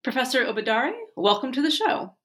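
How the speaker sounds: normal talking voice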